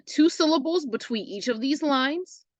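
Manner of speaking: ordinary delivery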